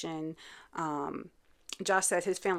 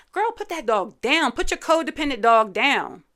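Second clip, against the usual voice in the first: exasperated call-out; 5 semitones higher, 7 dB louder than usual. Real, not acted. Scolding Megan on screen.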